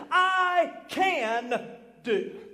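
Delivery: very slow, loud, stretched words; drawn-out emphasis. Acted. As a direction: stretch each word for the church.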